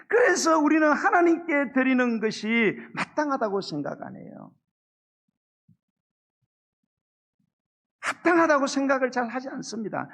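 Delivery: loud, high, fervent sermon delivery; full-force preaching. Acted. (목소리를 높여 힘차게 설교하듯)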